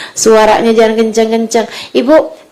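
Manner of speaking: ordinary delivery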